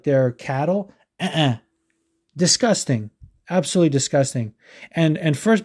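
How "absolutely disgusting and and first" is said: normal talking voice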